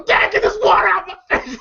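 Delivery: high, laughing shout; laughing mock yell. Son yelling at his dad over the water hose, high laughing shout. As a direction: yell it high through the giggles. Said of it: "you hear Day Day trying to say".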